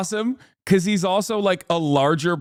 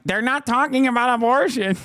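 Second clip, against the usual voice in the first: high, hurried mimicry of complainers; mock complaining viewers; 6 semitones higher, faster than usual. Streamer mimicking objecting viewers, high mock complaint. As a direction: parody a whiny objection, high and fast. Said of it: "people are like".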